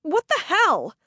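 outraged disbelief; angry at the attacker, indignant; indignant outburst. A narrator's reading. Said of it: "suddenly indignant".

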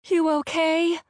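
high-pitched, anxious motherly question; worried mom. A narrator's reading. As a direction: check on your child, voice lifted. Worried mom checks on daughter.

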